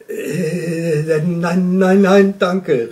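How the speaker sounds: loud, high stammered refusal; flustered call across a distance; calling back. A narrator's reading. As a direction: shout back a hesitant, repeated no. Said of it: "rief der Luchs zurück".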